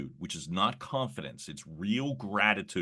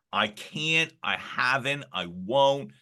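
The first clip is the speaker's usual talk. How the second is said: fearful excuses; 5 semitones higher, 6 dB louder, slower than usual. Retold or imitated. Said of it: "all the rationalizations, unnecessary fear".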